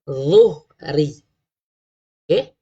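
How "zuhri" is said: "Zuhri" with tongue tip out on dh; careful pronunciation. Shown as a demonstration. Teacher showing the tongue position, slowly. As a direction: push the dh of "Zuhri" through the teeth. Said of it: "keluarkan hujung lidah kita".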